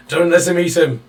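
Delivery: high, loud cry of protest; acted shout. Game master voicing Scott trying to stop the bite, shouting. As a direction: shout it out as an urgent plea. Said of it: "hear Scott just shout".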